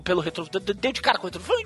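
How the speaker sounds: usual speaking voice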